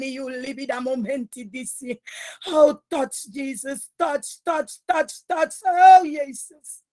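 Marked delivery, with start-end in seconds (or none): none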